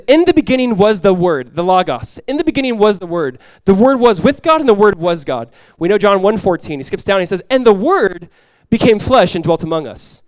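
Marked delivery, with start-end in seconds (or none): 0.1-5.5 loud, high preaching voice; ringing, emphatic Bible quotation; scripture proclamation. Acted. Proclaiming John's opening verses. 7.5-10.2 loud, high preaching voice; ringing, emphatic Bible quotation; scripture proclamation. Acted.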